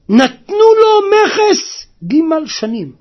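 slow, high, loud announcement; drawn-out high shout; loud proclamation. A narrator's reading. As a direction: proclaim it slowly at the top of the voice. Preacher loudly announces the offer.

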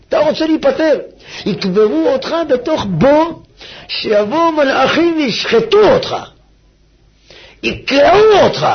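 slow, loud warning sermon; preacher threatening doom; fire and brimstone. Acted. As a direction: frighten them with the grave. Preaching at full force.

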